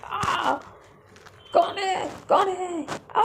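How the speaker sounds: high startled yell of कौन है; frightened scream. Retold or imitated. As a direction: scream in fright at the shape in the dark. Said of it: "चिल्ला रही". (डर से चीखना)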